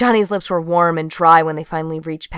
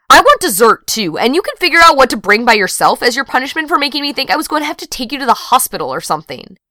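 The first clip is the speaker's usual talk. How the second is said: loud, fast scolding rant; fast, loud telling-off of a friend; mock scolding; 7 semitones higher, 7 dB louder, faster than usual. A narrator's reading. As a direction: scold her loudly and quickly, half-joking. Pat scolds Johnny after scare.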